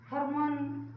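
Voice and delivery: normal talking voice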